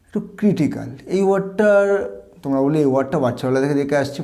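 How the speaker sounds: ordinary talk, nothing marked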